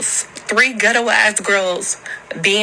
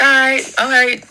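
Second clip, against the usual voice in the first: mock calm reply; 5 semitones higher, 4 dB louder than usual. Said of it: "I'm like".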